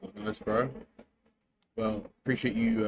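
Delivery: ordinary talk, nothing marked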